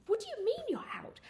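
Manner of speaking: hammed-up indignant outrage; high outraged cry at the clerk.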